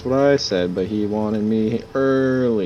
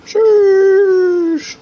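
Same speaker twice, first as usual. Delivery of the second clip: high squealed sheesh; 9 dB louder than usual. Pretend. Trading exaggerated sheesh calls.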